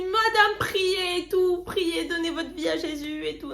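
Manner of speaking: high, urgent begging; urgent pleading. Retold or imitated. Begging the woman to give her life to Jesus.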